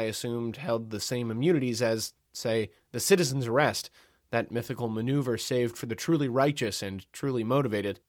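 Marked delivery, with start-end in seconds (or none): none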